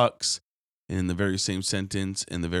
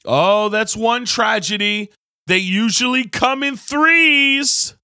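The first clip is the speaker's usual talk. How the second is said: much higher, louder, slow mocking voice; mock gloomy person; 12 semitones higher, 10 dB louder, slower than usual. Preacher mocks someone's superstition, high, loud and slow. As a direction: parody a superstitious gossip, high and drawn out. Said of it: "someone said to me".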